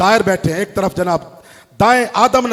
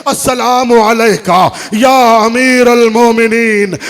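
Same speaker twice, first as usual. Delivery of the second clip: wailing greeting; 4 semitones higher, 11 dB louder, slower than usual. Acted. Little girl's greeting, wailing.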